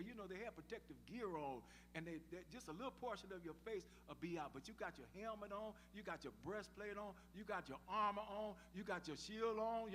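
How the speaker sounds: ordinary talk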